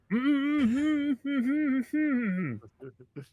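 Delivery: high-pitched urgent wordless mumbling; high muffled "mm-hmm"s; muffled mouth-covered humming. Acted. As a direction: hum frantically as if a hand covers your mouth. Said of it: "he, he says".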